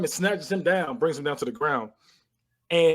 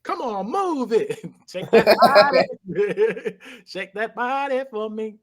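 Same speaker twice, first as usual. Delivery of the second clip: slow, laughing, higher sassy "shake that body"; sassy runway coaching; 5 semitones higher, much slower than usual. Retold or imitated. Mimicking the runway coach for a friend, slow and sassy. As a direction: drawl it out like a runway coach, giggling. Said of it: "started runway modeling it".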